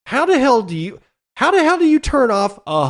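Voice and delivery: high, loud burst of disbelief; how the hell do you, blurted way up in pitch; exasperated disbelief. Real, not acted. Host baffled at a hundred exahashes vanishing, incredulous outburst.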